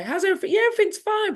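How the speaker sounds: high, bright put-on cheer hiding the chaos; cheery work-mask voice for colleagues; fake cheerful mask. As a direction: sing it out like all is fine. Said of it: "but wearing this mask".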